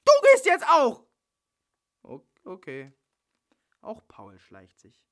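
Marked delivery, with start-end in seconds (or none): 0.0-1.1 very high, loud order; furious shout; angry shout. Acted. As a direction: throw him out with a yell. Bella throwing Paul out.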